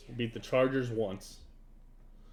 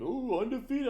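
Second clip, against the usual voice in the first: mock taunt; 12 semitones higher than usual. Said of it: "He was like".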